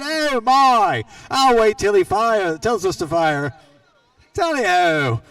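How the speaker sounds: mock British exclamation, only lightly done; bad British accent. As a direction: play a flustered British chap, badly. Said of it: "That's the worst British impression you're gonna get out".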